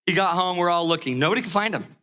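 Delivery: ordinary talk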